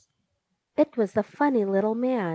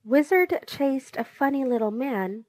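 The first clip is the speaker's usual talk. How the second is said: ordinary delivery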